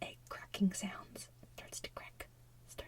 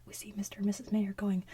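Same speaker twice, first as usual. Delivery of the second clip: ordinary talk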